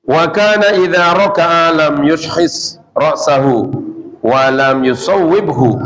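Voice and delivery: slow, level chanted Arabic; hadith recitation. Shown as a demonstration. Teacher reading the next hadith line to students, slow recitation. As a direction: recite the text slowly with drawn-out vowels.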